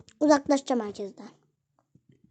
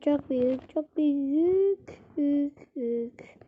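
slow drawn-out awe; 5 dB quieter, much slower than usual. Real, not acted. Gamer marvelling at his huge cell, slow and fading. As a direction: stretch each word slowly, fading away.

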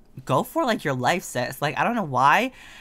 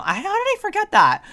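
high, loud squeal of disbelief; a piercing squeak of horror; dismayed shriek; 6 dB louder than usual. Real, not acted. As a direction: shriek it at your own mistake. Notices unpainted window, dismayed.

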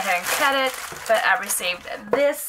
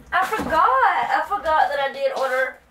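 laughing; 7 semitones higher than usual. Real, not acted. Laughs at forgotten order.

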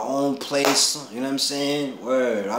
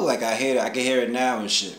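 ordinary talk

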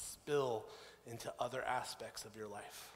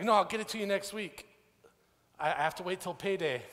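higher, louder imitation of a stalling friend; brush-off excuse; 6 semitones higher, 6 dB louder than usual. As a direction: fend off the debt collector, pitched up. Friend puts off paying him.

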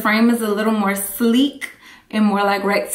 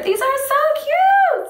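gushing. Real, not acted.

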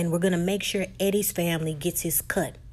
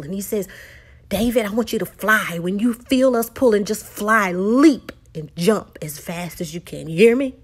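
louder, driving call to pull; rallying shout; 5 dB louder than usual. Retold or imitated. Paul to the rescuers, a loud rallying call. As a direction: fire them up to pull together.

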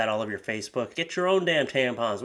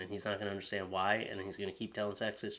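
much softer, whispered; soft gloomy murmur; hushed; 5 semitones lower, 13 dB quieter than usual. Real, not acted. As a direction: mutter the verdict to yourself. Trailing gloomy outro.

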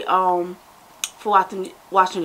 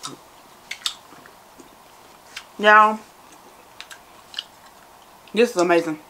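eating and describing taste; talking while chewing. Real, not acted. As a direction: mouth full of candy. Seen 1: Right speaker's jaw and lips keep chewing between words; candy bowl near.

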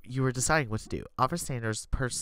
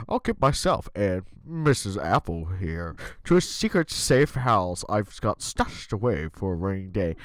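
officer voice; 6 semitones lower, 3 dB louder, slower than usual. Acted. GM voicing Officer Sanders.

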